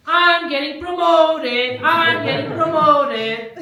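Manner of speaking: high, loud, drawn-out celebration; slow singsong victory cheer; gleeful singsong. Acted. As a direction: chant it like a gloating winner. Acting out pawn celebrating promotion.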